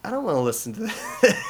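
laughing reluctant groan. Retold or imitated. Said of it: "And I'm like".

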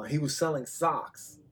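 ordinary talk

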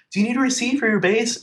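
high-pitched mock holler; imitated shout. Store worker calls to a customer across the store, shouting. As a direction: call it out as if across a room, pitched well up. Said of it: "he's shouting at me from across the store".

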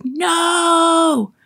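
high mock shout of a crowd of kids. Brothers and sisters protesting to their brother, high mock shout. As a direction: yell it like protesting siblings.

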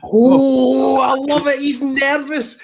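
high, drawn-out delighted crow; gleeful delight. Real, not acted. Host teasing a nervous guest, gleeful delight. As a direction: revel in their nerves with a high-pitched whoop.

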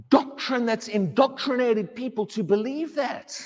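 normal talking voice

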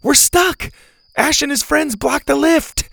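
high, loud yell for rescue; high-pitched hollered explanation; shouted. Acted. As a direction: shout the news down urgently. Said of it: "they shouted".

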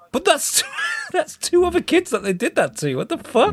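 very high, incredulous laughing protest; dawning horror, acted. Viewer realising other kids were killed, high-pitched mock outrage. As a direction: squeak it out in mock disbelief. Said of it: "but then you're like".

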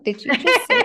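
high, loud, laughing "did you just say?"; playfully mimicking scandalized bystanders, laughing; mock shocked gasp. Strangers in line reacting to dark jokes, mock shocked and laughing.

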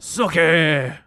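loud drawn-out Southern call; Southern accent character. Acted. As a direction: holler the name back with a Southern drawl. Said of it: "more like a Charleston Southern accent".

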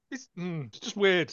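ordinary talk, nothing marked

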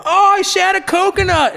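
loud, very high-pitched yell; proud kid's high shout; acted-out announcement. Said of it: "be like".

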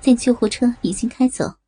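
ordinary delivery, nothing marked

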